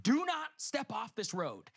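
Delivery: slow, high, emphatic warning; imitated guide's careful command; stern warning. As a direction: spell out the danger slowly. Acting tour guide's warning.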